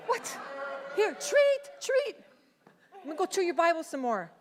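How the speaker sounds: squeaky mock dog voice, teasing; chihuahua voice. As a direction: play the sassy chihuahua. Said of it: "he comes up to you and he's like".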